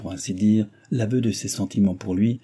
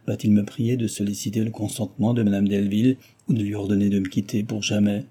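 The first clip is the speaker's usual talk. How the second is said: usual speaking voice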